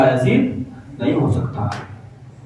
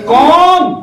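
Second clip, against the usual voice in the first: high, loud sharp call through the door; a mother's sharp demand from inside; 7 dB louder, much slower than usual. Acted. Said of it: "بڑے تیکھے لہجے میں آواز آئی". (تیکھے لہجے میں)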